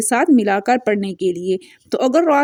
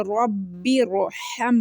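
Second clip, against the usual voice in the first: 1 Quranic recitation; 2 semitones lower, 7 dB quieter, slower than usual. Shown as a demonstration. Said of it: "अब इसमें देखिए".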